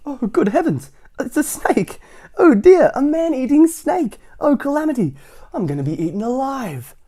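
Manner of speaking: very high, loud panicked wail; hammed-up terror. Mister Jelly seeing the worm, wailing in mock terror. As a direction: shriek as if facing a monster.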